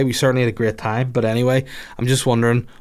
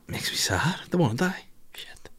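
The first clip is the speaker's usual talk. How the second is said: near tears; 5 semitones higher, 8 dB quieter than usual. Real, not acted. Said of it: "I'm gonna fucking cry".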